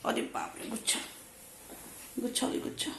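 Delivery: hushed unvoiced whisper. Real, not acted. Mother whispering in surprise at the baby's mess. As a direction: whisper in mock alarm.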